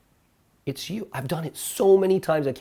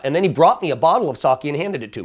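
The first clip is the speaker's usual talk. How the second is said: ordinary talk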